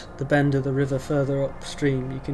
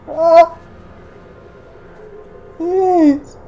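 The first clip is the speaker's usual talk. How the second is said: high, loud strangled plea; 10 dB louder than usual. Acted. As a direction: choke out a desperate cry for help. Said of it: "like a strangled humanoid voice".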